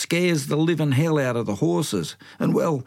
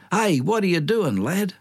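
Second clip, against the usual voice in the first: higher call across the pub; 8 semitones higher than usual. Retold or imitated. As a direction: horse tailer calling across the pub to the lad, higher call. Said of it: "he calls out".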